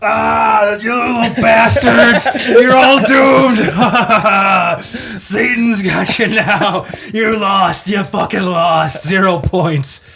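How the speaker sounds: loud, high, slow devilish taunting; slow ominous raspy drawl. Acted. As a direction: gloat like Satan taking souls. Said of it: "a little shtick that I did last year".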